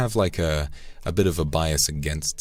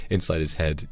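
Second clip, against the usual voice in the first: ordinary delivery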